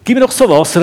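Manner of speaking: mocking woman demanding water; cheeky. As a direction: toss it off like a cheeky challenge. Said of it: "Und auch noch ganz frech".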